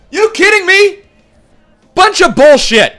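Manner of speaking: furious shout of disbelief; angry disbelief. Real, not acted. Raging at sim result.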